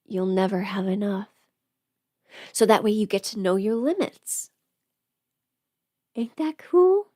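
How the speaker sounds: ordinary delivery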